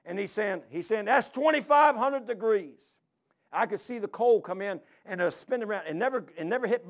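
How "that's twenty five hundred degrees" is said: loud, high, drawn-out shout; retold yell. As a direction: yell it slowly over the furnace's roar. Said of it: "he's yelling at me".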